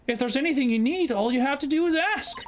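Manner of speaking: high, friendly character voice; eager helper. Acted. Lawyer offering help to a new client, high and eager.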